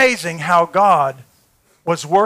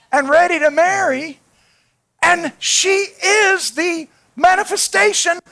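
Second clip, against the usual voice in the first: fiery preaching; 11 semitones higher, 4 dB louder than usual. Acted. Preacher retelling his bomb to Matt, full force. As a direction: drive the point home to the crowd.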